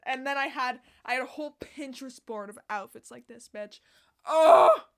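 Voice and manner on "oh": loud thrilled cry; excited shout. Real, not acted. Vlogger bursting with excitement, loud cry. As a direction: burst out with joy.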